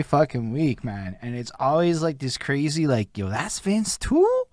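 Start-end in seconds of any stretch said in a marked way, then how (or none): 3.2-4.4 high, slow amazed question; imitating a listener's amazed discovery; surprised quote. Host retells fans hearing producer's beats, amazed question.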